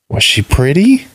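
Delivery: put-on high little boy asking; curious child. A narrator's reading. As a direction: innocent childish question. Vali asks about his mother.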